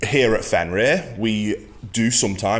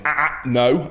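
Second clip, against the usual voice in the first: stern correction; 6 semitones higher than usual. Shown as a demonstration. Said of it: "that vocal inflection".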